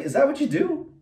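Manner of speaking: high, incredulous accusation; mock indignant challenge. To the cheating vendor, incredulous accusation. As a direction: call him out in outrage. Confronting a swindling seller.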